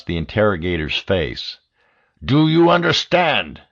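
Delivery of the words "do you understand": "you" pushed higher and louder; louder, higher furious repeat; angry repeat. A narrator's reading. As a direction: demand it again, harder.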